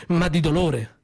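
ordinary delivery

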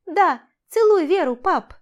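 high, loud, eager call; excited child's urging. A narrator's reading. Child urging dad to kiss the bride, excited shout. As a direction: cheer him on, high and loud.

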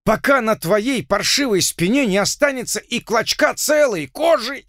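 outraged colonel. Acted. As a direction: rage at the insolent doctor. (возмущённый полковник)

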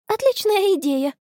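very high-pitched eager exclamation; over-eager agreement. A narrator's reading. Friend seizes on the offer of water, over-eager. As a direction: jump at the excuse to get away. Конни grabs excuse to flee.

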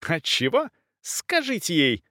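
high, demanding voice; affronted countess pressing for answers; indignant insistence. A narrator's reading. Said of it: "продолжала графиня".

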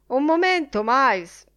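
loud, high call to stop someone. A narrator's reading. O'Molloy calls after the editor, calling out. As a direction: call after him sharply and loudly.

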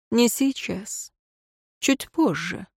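soft, slow inner voice; hushed, sly self-talk; secret thought. A narrator's reading. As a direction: murmur it to yourself, unhurried.

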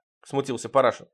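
ordinary delivery

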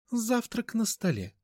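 high, slow, mannered lady. Acted. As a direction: call out in a lofty mannered tone. Mother speaks, same voice.

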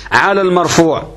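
normal talking voice

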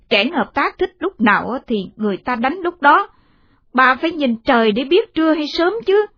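cross daughter-in-law telling off; scolding. Acted. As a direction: scold her with mounting pitch. Daughter-in-law scolds her mother-in-law.

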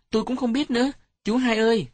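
put-on high-pitched child's voice; playful child. A narrator's reading. Child playing along with uncle. (giọng trẻ con cao vút, tinh nghịch)

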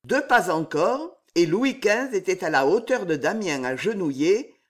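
ordinary delivery, nothing marked

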